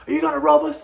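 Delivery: loud, high accusation; imitated fired-up demand. Tourists confront club fixer about being robbed, fired up. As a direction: confront him, voice pitched right up. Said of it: "we're fucking fired up at this point".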